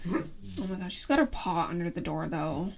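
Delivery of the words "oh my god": usual speaking voice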